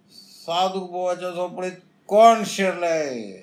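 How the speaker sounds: high, loud challenge into the hut; calling out loud. Acted. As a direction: demand who's inside, high and loud. Friend calls into locked hut.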